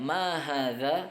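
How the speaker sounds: Ma hadza asked slowly with a rising end; teacher modelling an Arabic question; slow model question. Shown as a demonstration.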